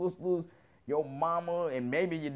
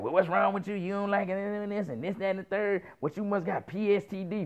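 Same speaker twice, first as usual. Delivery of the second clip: higher, sassy woman's voice; imitating the chick's higher, mocking questions; 5 semitones higher than usual. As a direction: needle him like the girl did, pitched up.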